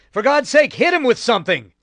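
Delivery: a panicked Marine pleading for force; desperate shout. A narrator's reading. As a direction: yell at them in desperation. Desperate Marine urging others.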